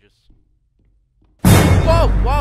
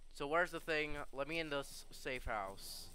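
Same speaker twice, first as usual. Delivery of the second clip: usual speaking voice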